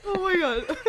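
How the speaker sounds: high laughing cry. Real, not acted. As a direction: break up with laughter. Can't stop laughing at syrup.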